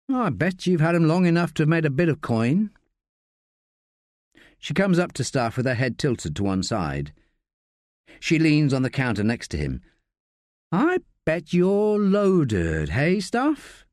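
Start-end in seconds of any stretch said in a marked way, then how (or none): 0.1-2.7 lightly voiced higher, teasing woman; Josie, teasing. Josie probing Staff about his money, higher and teasing. 10.7-13.8 high, slow, drawn-out teasing; playful teasing. Acted. Josie needling Staff about being loaded, slow and teasing.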